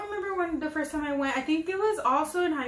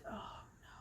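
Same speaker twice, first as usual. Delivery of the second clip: whispered oh no in dread; scared whisper to herself; 24 dB quieter than usual. Retold or imitated. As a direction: breathe it out in fear. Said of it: "I was like".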